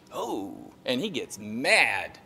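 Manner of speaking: ordinary talk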